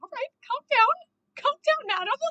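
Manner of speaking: high exasperated plea to settle down; high-pitched placating. Acted. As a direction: talk down someone who is overreacting, pitched high. Acted reply to the shouting character.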